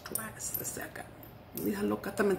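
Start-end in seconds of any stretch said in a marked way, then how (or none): 0.0-1.0 whispered aside. Real, not acted. Soft aside.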